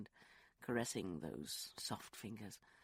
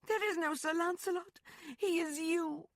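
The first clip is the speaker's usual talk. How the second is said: high, loud woman's voice; Rosalind, insistent; 7 dB louder than usual. Acted. Woman insists to Lance he is the hero, high and loud. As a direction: press the truth on him.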